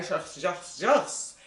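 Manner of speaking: put-on "Joyce" said with a French accent, the oi flattened and not a real oi sound; French way of saying Joyce. Mimicking French speakers mangling the name Joyce. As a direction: say "Joyce" the way the French cannot manage oi. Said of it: "Eles não conseguem falar oi".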